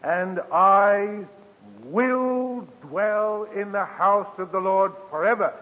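hushed, lifted reading of the verse; scripture recital. Acted. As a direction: draw out every word like a vow. Preacher reciting psalm verse, drawn out.

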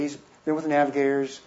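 ordinary talk

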